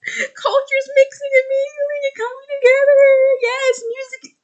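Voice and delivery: very high, loud, squealing delight close to tears; gushing excitement. Retold or imitated. Fan telling viewers her reaction: high, teary squealing rapture. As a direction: gush like it's the best thing ever. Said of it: "and I'm like".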